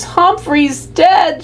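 high, slow, sobbing wail; a little girl bursting into tears. A narrator's reading. Daughter sobbing that the hamster is dead, high slow wail. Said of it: "she sobbed".

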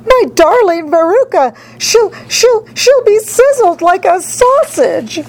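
very high, wailing mother; yelling. A narrator's reading. Mother fears her daughter will burn, high wailing yell. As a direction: wail it out high, stammering and drawing out the end. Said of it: "yelled Missus Salt".

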